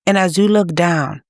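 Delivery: normal talking voice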